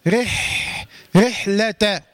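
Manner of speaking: رحلة recited with audible breath flowing through the ح; hams on haa. Shown as a demonstration. Teacher showing the airflow of haa, breathy consonant in recitation. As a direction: release a long airy ح after the light r. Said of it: "الهمس".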